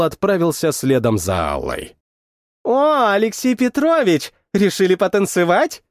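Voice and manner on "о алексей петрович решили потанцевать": put-on teasing girls' voice. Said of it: "Засмеялись девушки".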